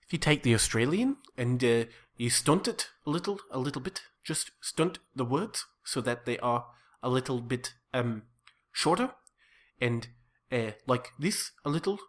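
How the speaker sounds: Australian stunted into South African; South African accent. Shown as a demonstration. Improviser demonstrating how to stunt Australian, South African accent. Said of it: "it becomes more of a South African accent".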